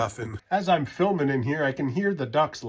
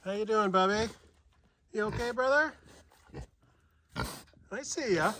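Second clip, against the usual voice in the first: pet-talk voice; 6 semitones higher than usual. Real, not acted. Cooing to the animals.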